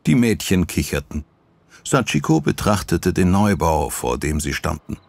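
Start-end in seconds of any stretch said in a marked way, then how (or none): none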